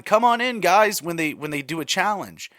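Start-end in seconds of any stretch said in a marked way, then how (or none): 0.0-1.0 hosting call, higher and louder; Jeff's iconic line. Retold or imitated. Host calling contestants into the challenge, upbeat. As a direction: belt it like the show host. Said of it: "our iconic line".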